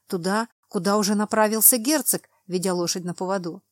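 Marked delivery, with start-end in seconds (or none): none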